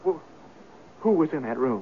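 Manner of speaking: breathless, dropping to a near whisper; a shaken whisper that catches on the first word; hushed stunned question. Acted. Lodger asks the landlady who slept there, hushed and stunned.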